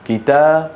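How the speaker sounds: كتاب ending in a sounded -in, kitaabin; genitive tanween. Shown as a demonstration. Teacher shows the case endings, كتاب as kitaabin. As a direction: finish كتاب with the nun ending -in. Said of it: "مجرور".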